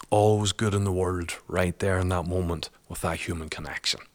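ordinary talk

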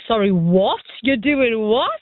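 incredulous questions, each "what" swooping up; imitated disbelief. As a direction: play the baffled reaction to the news. Acting out people's baffled reactions.

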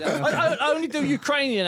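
laughing through the words. Real, not acted. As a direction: stumble out a laugh. Laughing reply to teasing.

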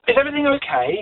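put-on salesman's polite French-accented question. French salesman asks the customer, light French accent. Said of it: "in his beautiful accent".

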